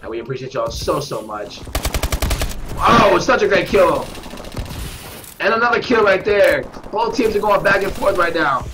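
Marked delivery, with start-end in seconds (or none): none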